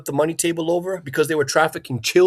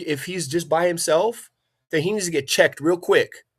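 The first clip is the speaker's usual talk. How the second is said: usual speaking voice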